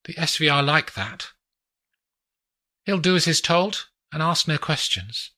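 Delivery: woman showing off the view; Adelina's voice. Acted.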